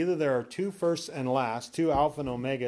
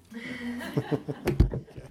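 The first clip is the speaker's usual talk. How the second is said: amused laugh; 11 semitones higher than usual. Real, not acted. Amused at his own joke.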